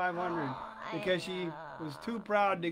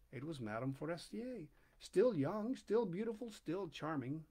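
usual speaking voice

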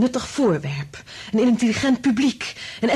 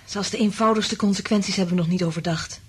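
usual speaking voice

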